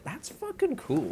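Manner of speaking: squeaky high "That's fucking cool"; mock awe. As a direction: gush it way up high. Own mock reaction.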